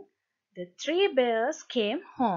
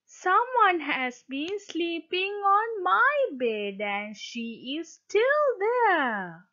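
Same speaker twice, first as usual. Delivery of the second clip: higher little bear voice; a small cub, a bit higher; Baby Bear; 6 semitones higher than usual. A narrator's reading. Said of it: "Then Baby Bear said".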